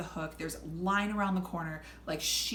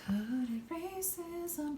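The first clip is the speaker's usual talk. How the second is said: knowing aside; 8 semitones higher, 6 dB quieter, much slower than usual. Retold or imitated. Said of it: "And I was like".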